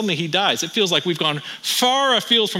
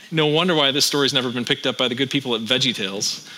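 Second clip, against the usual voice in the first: normal talking voice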